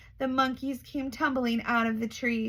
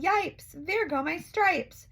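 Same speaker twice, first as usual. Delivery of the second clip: zebra yelling; 7 semitones higher, slower than usual. Acted. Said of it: "The zebra yelled".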